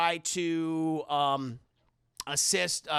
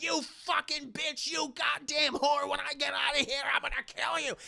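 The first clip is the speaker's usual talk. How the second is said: Chucky; 7 semitones higher than usual. Acted. Trapped doll threatens Karen, high strained venom.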